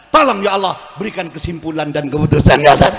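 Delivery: husband's blunt prayer. Retold or imitated. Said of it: "kalau bapak ini biasanya".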